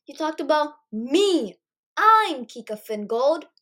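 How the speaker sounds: loud, slow furious scream of her own name. Acted. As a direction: scream it so the whole corridor hears. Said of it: "I screamed".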